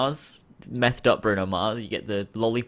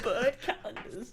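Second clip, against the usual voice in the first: higher, softer words through giggles; lingering laughter; 9 semitones higher, 6 dB quieter than usual. Real, not acted. Friend still amused by bird calendars, giggling speech. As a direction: giggle it out weakly.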